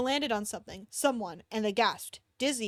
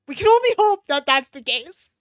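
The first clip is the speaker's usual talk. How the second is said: mock hope; 4 semitones higher, 11 dB louder than usual. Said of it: "dramatic hopeful".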